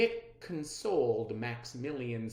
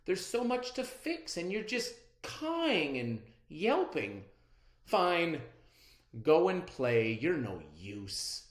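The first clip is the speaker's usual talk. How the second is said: higher, louder, annoyed scolding of the bird; cross; 5 semitones higher, 5 dB louder than usual. A narrator's reading. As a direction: snap at the bird in frustration. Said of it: "Izzy got mad".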